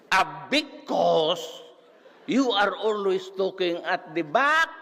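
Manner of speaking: high mock scolding, rising to a screech; shrill angry professor. Professor ordering talker to the front, shrill scolding.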